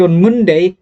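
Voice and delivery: ordinary delivery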